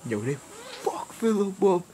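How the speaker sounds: slow, high-pitched disbelief; stunned Philip. Retold or imitated. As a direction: drag it out, high and aghast. Acting Philip's shocked reaction.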